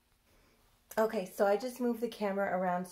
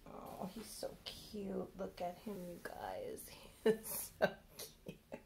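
soft breathy adoring whisper; 13 dB quieter than usual. Real, not acted. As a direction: whisper so as not to wake him. Whispers over the sleeping-looking doll.

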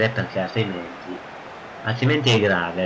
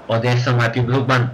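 normal talking voice

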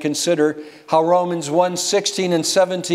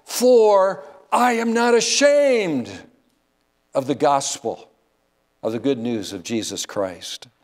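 preacher quoting Paul with climactic weight; emphatic quote. Retold or imitated. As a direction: lean hard on "For I am not ashamed", then let it drop.

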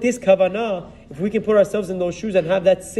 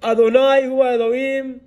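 higher, long-held proclamation; retold crying out; 4 semitones higher than usual. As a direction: proclaim it like a whole nation crying out. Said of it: "what do they cry out?".

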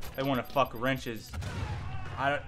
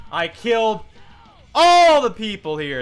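angry shout; 3 semitones higher, 6 dB louder than usual. Real, not acted. Raging at game.

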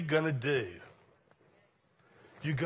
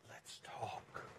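soft, intimate whisper; 3 semitones lower, 12 dB quieter than usual. Acted. Preacher voicing God whispering to you every day, whispering. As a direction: whisper it as a gentle invitation. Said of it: "he's whispering to you every day".

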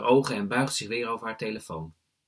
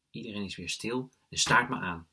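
ordinary delivery, nothing marked

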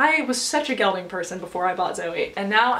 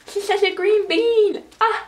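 high, drawn-out cooing voice; cutesy gushing; 11 semitones higher, slower than usual. Real, not acted. Rider gushing over her six-year-old horse, cutesy. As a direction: coo it slowly, way up high.

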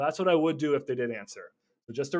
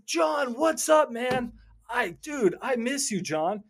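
excited shout; 10 semitones higher, 4 dB louder, much slower than usual. Acted. Greeting a forgotten caller.

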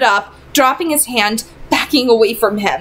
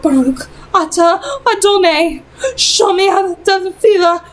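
fearful praying; 8 semitones higher than usual. A narrator's reading. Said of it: "She began to whisper".

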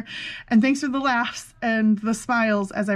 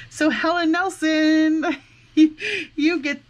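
laughing; 6 semitones higher, much slower than usual. Real, not acted. Announcing winner, laughing.

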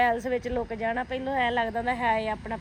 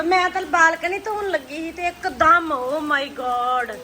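loud panicked exclaiming; 3 semitones higher, 11 dB louder, slower than usual. Real, not acted. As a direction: cry out in alarm. Rain floods in, loud dismay.

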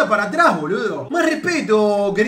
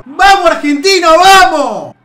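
cheering shout; 4 semitones higher, 8 dB louder than usual. Real, not acted. Cheering for Argentina.

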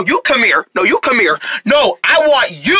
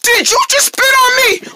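high-pitched indignant accusation; outraged disbelief; 14 semitones higher than usual. Acted. Soki to the spitter who hit him, outraged. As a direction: demand it in shocked outrage.

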